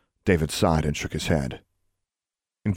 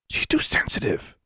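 voiceless scornful whisper; scoffing whisper; 3 semitones higher than usual. A narrator's reading. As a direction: brush off the friend under the breath. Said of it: "Alexandra scoffed".

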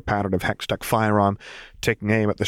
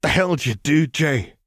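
hissed; 7 semitones higher than usual. A narrator's reading. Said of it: "the bartender hissed".

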